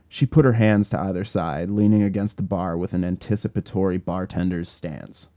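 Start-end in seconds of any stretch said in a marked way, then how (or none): none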